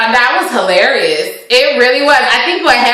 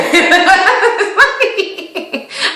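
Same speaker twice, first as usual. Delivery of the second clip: helpless high laughter; long real giggle; high giggling fit; 9 semitones higher than usual. Real, not acted. Recapper cracks up at her own impression, high giggles.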